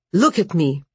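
a touch of angry impatience; snapped command. A narrator's reading. Morosco orders Vasya to face him, snapped. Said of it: "He snapped".

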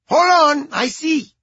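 put-on high Cuban-accented woman. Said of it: "A woman with a thick Cuban accent".

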